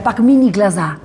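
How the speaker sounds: lightly voiced director urging her in Russian; mild mock of the director coaching her to cry; imitating the Russian director. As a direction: director telling actress to cry. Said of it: "que es llora".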